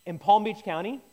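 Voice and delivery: ordinary talk, nothing marked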